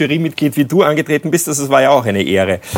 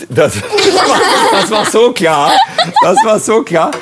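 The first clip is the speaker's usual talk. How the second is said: laughing; 7 semitones higher, 4 dB louder than usual. Real, not acted. Laughing at the obvious pick.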